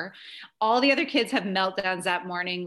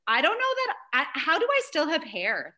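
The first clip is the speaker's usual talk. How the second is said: higher, exasperated self-quote; mock disbelief; 7 semitones higher than usual. Mom looking back at chaotic days, mock exasperation. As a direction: marvel at having survived it.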